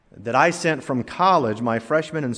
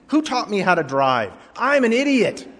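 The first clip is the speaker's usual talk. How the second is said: higher mock self-rebuke; mock self-scolding; 6 semitones higher than usual. Driver talking to himself after cutting lanes, mock self-scolding. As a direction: scold yourself in mock disbelief.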